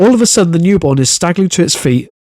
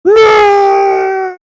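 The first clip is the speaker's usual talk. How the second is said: falling foal scream. Pretend. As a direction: scream like a panicked foal tumbling into the air. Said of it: "Can you do that for us?".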